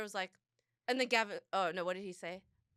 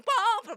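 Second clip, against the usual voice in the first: much higher, louder sung bong; imitated piano bong; 12 semitones higher, 9 dB louder than usual. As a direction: bang out a mock piano chord with your voice. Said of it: "the most obnoxious bong".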